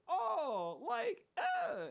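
high, loud mock voice; mock clueless voice. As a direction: put on a high airy know-it-all voice. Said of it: "It'd be like".

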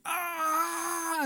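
a short high cry, held back and soft; high pained cry, muted imitation; imitated scream. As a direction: mimic the child's pained scream without full volume. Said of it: "But he was just screaming like".

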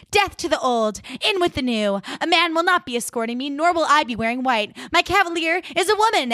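high-pitched mock-grand declaration; defiant proclamation. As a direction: proclaim it like a theatrical rebel heroine. Monet's defiant entrance, acted out.

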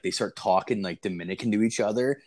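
ordinary delivery

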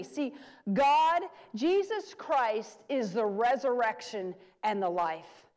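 ordinary delivery

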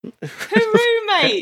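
a high laughing hooroo, mate. Pretend. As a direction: chuckle through an Aussie goodbye. Laughing mock Aussie farewell.

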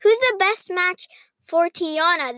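ordinary talk, nothing marked

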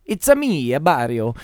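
high Italian plumber voice; cartoon Italian plumber; Mario voice. Mario catchphrase parody.

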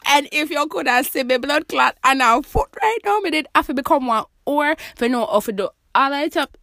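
loud wine-fuelled patois outburst; tipsy patois rant. Real, not acted. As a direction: let loose in loud patois. I'm never recording again when I'm drinking wine.

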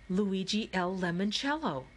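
ordinary delivery

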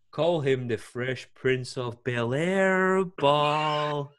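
slow, drawn-out announcer call; announcer mock. Arena commentator mocking the drunk guy's missed shots, drawn out. Commentator ribbing him on mic.

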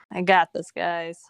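normal talking voice

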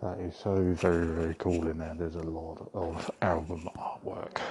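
hushed gallery whisper, muffled behind a mask. Real, not acted. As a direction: keep it low so staff don't hear. Whispering covertly inside gallery.

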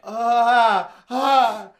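loud, high "ah" of alarm; acted-out yelps of horror; imitated scream. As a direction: cry out in shock, acting it. Reenacts own reaction to film.

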